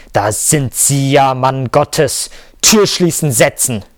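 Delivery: loud, high bellowed orders; roaring. A narrator's reading. Boss ordering employee into his office, roaring. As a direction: shout commands like a choleric boss. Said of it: "brüllte er".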